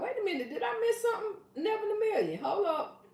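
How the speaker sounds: high surprised did-I-miss-that. Real, not acted. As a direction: act like you just noticed. Surprised at missing comment.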